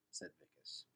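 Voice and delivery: ordinary delivery, nothing marked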